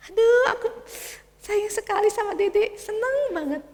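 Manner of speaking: put-on high child's voice, teary; a boy overflowing with love; tender little boy. Michael sayang adiknya. (suara anak kecil penuh sayang)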